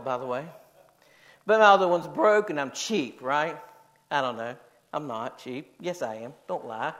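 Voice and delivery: ordinary delivery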